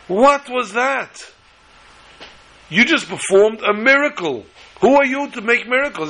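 the foreman marvels at his boss; amazed. Acted.

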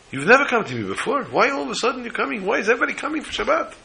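high, rising astonished questions; astonished questioning. Retold or imitated. Rabbi puzzled by crowd of poor.

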